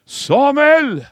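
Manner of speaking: high, loud call across the dark; a ringing divine call, high and loud. Acted. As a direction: call his name from far off. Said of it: "någon ropar hans namn".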